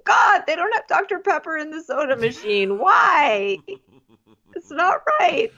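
high, whiny mock sob; mock sobbing. Bachelor crying at the soda machine, high whiny mock sob. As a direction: whimper it high like a pathetic crybaby. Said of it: "sobs by the ice machine".

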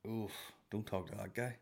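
soft, mock-wary aside; acting out a discreet warning; hushed warning. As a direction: warn a friend off under your breath. Voices people warning each other off him.

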